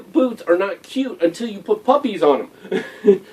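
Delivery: ordinary talk, nothing marked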